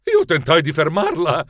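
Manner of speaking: ordinary delivery, nothing marked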